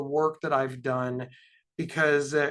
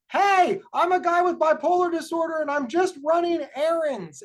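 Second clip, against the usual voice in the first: raised, much higher public announcement yell; mock yelling; 11 semitones higher, 3 dB louder than usual. Speaker yelling to be noticed while doing well, mock yell. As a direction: yell it out high, as if to passers-by. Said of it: "I have to start yelling".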